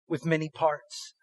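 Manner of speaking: usual speaking voice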